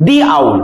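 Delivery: "দি owl" with "দি" said as "thee" before the vowel; teaching pronunciation. Shown as a demonstration. Teacher demonstrates "দি" said as "thee" before a vowel. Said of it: "এটাকে বলবো দি".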